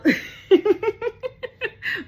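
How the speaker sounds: high, loud burst of laughter. Real, not acted. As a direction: crack up at your own joke. Laughing at own joke.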